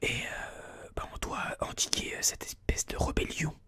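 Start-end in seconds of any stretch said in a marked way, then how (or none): none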